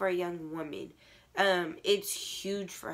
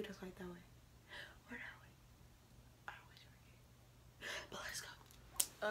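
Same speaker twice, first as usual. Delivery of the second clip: whispering; 20 dB quieter than usual. Real, not acted. Muttering to herself while filming.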